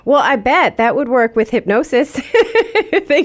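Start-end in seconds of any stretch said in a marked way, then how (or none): 2.2-3.0 short high laugh; real amused laugh. Real, not acted. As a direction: laugh at your own joke. Host laughs at own joke.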